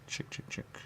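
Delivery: whispered calling a chicken; a soft chick-chick call. Pretend.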